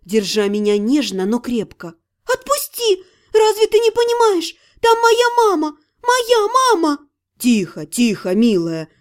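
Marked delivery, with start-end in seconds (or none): 2.2-7.0 much higher, frantic cry; desperate shout. Acted. Heroine begs to go save her mother, high and frantic. 7.4-8.9 put-on calm her like a frightened child; soothing. Ирга hushes the struggling heroine, lower and soothing. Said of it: "увещевал Ирга".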